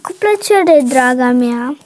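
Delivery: low, calm mother voice. Acted. Mother telling her daughter about the new tablet, low and calm. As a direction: speak low and gentle like a mom.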